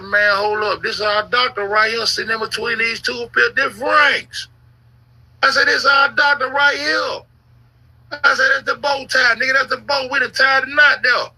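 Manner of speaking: near-yelling the discovery, stretching every word; excited discovery. Retold or imitated. Re-living spotting the doctor in the photo, high excited shout.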